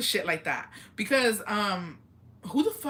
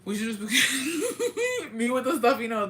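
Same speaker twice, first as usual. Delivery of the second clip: real laughter breaking into a slower, higher line; laughing through words; 5 semitones higher, slower than usual. Real, not acted. Streamer amused at own stuffy nose, laughing through it. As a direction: talk while cracking up.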